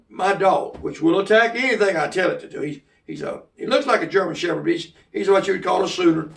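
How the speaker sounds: ordinary talk, nothing marked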